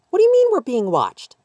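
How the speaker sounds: put-on high, loud child's voice asking the air; little girl questioning. A narrator's reading. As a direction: demand it like a puzzled little girl. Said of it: "the little girl questioned nobody".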